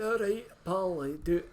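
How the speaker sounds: high, loud put-on Scottish accent; imitating a big Glaswegian customer in a Scottish accent. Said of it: "in his big Scottish accent".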